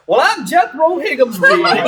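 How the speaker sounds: high, loud, slow salesman introduction; Jethro Higginsby salesman voice. Acted. Player shows how his salesman character introduces himself, loud and slow. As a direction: sell your own name like a pitch. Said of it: "I have my normal voice".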